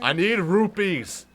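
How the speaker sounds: drawn-out, high and loud plea; playful loud cry. Pretend. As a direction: wail it slowly and loudly for effect. Both chanting for rupees in game.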